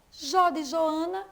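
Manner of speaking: ordinary delivery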